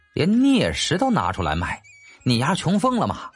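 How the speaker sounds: ordinary delivery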